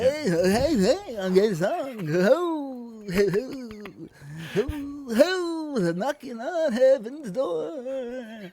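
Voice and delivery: high, slow, nasal-free mock singing. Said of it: "Do your [name] again".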